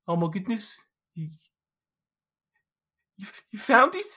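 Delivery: high, astonished Geordie gnome voice; amazed gnome. Acted. GM voicing Geordie seeing his necklace, high amazed voice. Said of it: "and he goes".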